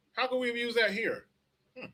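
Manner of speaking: high, soft mock-innocent question. Mocking the Ukraine aid logic.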